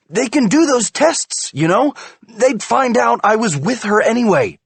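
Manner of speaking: high, loud desperate explaining; desperate explanation. A narrator's reading. Husband explains police pressure to wife, desperate. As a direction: defend yourself frantically.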